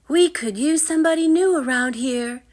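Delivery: put-on high, loud, bright grinning girl; a girl grinning as she welcomes him. Anna welcomes the new boy, grinning, high and loud. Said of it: "Anna said with a grin".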